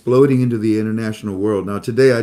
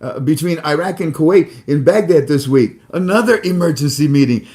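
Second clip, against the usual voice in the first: imitating a friend rattling off news, higher and louder; breathless rapid-fire excitement, pitched up; all excited; 6 semitones higher, 6 dB louder than usual. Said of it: "rapid firing what he's seeing because he's all excited".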